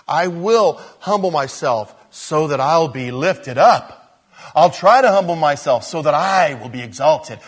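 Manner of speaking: hint of eager, self-serving resolve; self-seeking believer. A believer vowing humility to be lifted up, mock earnest.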